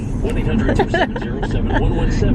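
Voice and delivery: high breathy giggle. Real, not acted. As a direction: laugh playfully. Laugh after teasing.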